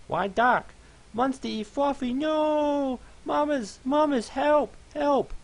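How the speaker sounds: very high, loud shrieks for help; a trapped pony screaming for mama; screaming fluffy pony. Acted.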